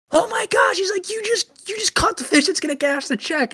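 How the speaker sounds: very high mock-astonished squeal; amazed falsetto. Fishing partner amazed at the catch, mimicked in squealing falsetto. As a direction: imitate him losing it in an exaggerated falsetto.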